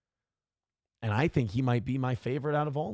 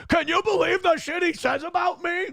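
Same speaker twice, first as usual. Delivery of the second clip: high whiny complaint; mock whining; 13 semitones higher than usual. Mocking a rival who complains about insults, high whine. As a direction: whine to the crowd for sympathy. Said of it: "And you go".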